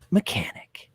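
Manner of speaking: breathy whispered word; whispering. Real, not acted. Whispered word while describing.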